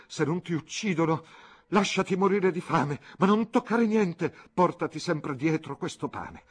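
sempre più acuta e forte, poi lenta; esaltata. Acted. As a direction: crescendo appassionato fino al culmine. Tutta esaltata, e disse. (Clelia esaltata implora Fabrizio, in crescendo)

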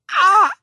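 very high loud scream; sudden piercing shriek; throat-cut shriek. A narrator's reading. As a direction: scream as if your throat were being cut. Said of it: "als durchtrennte ihr jemand die Gurgel".

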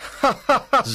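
big hearty hero laugh; loud laugh. Acted. Zorro mocking the sergeant, loud laugh. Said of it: "Zorro laughs loudly".

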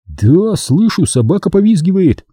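high, eager confirmation; lifted, excited comrade's voice; excited realisation. A narrator's reading. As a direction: catch the sound and pipe up. Genka excitedly hears the hunt.

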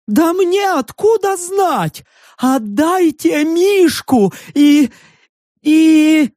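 high, slow whiny plea from a furry freak; high slow whining creature voice; high pleading creature. A narrator's reading. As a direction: plead like a nervous little beast.

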